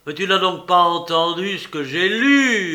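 loud, high furious yell. A narrator's reading. Le père hurle contre sa femme qui minimise. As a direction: bellow at the wife who won't listen. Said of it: "hurla Jujube".

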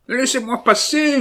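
very high, loud scream; yelled. A narrator's reading. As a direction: shout to force his way out. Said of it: "hurla l'assassin".

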